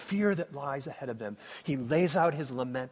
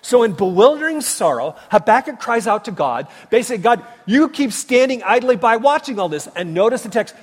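loud, fast, high-pitched preaching; full-force preaching; 6 semitones higher, 13 dB louder than usual. Acted. Pastor thundering to his congregation, full-force preaching. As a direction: drive the sermon home at full volume.

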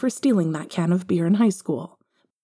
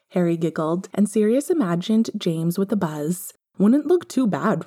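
usual speaking voice

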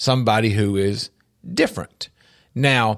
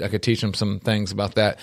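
usual speaking voice

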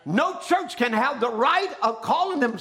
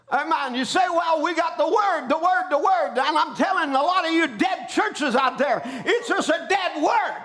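high, driving preacher's cadence; fired-up preaching; 5 semitones higher than usual. Real, not acted. Preacher rousing the congregation against dead churches, fired-up preaching. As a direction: drive each phrase home, drawing out the last words.

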